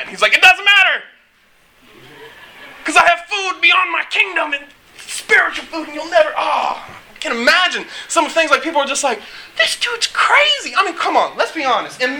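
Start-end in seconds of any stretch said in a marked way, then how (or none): 0.3-6.3 acted exclamation, much higher, starting loud; impassioned outburst. Jesus waving off the disciples' worry about eating, impassioned mock outburst. Acting out Jesus dismissing food worries. 9.6-10.7 slow, very high-pitched incredulous imitation; mock disbelief. People reacting to Jesus's wild sayings, mock disbelief.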